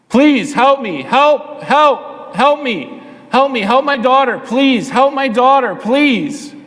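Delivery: high-pitched, pleading cries for help; desperate yelling. Acted. Desperate mother yelling after Jesus for her daughter, acted pleading yell. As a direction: yell pleas in short, repeated bursts. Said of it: "she just started yelling".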